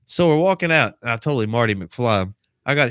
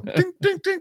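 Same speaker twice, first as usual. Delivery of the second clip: high, quick repeated "ding" sounds; mimicked gunshots. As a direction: imitate shots pinging off targets. Imitates sniper picking off targets.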